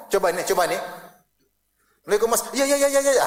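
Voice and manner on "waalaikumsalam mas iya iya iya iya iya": high, loud mock-timid yeses; scared husband. Frightened husband answers his wife, hurried timid agreement. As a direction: imitate a cowed husband agreeing over and over. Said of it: "suami saya takut".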